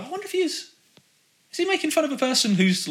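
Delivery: very high, softened voice that comes gradually down; puzzled wondering. Retold or imitated. Viewer rewatching the clip, wondering in a high voice. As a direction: lightly act out private wondering. Acting out own wondering while watching.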